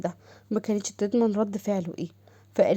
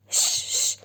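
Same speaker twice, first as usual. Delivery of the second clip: hushing; much slower than usual. Acted. Saqr hushing her.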